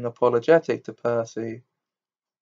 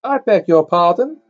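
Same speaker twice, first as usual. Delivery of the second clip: put-on prim, higher, louder girl's voice; stiff, affronted schoolgirl; 5 semitones higher, 6 dB louder than usual. A narrator's reading. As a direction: bristle like an offended schoolgirl. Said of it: "said the girl stiffly".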